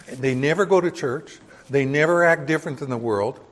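usual speaking voice

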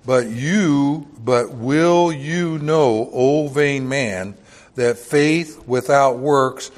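very slow, loud, drawn-out King James words; weighty pulpit recital; slow scripture reading. Acted. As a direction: stretch each word of the verse. Reads scripture very slowly.

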